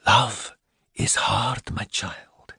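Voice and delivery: slow tender whisper. Acted. Minerva comforting Milly on the verge of tears, whispering slowly. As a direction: breathe it to comfort her. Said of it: "Missus Coleman whispered".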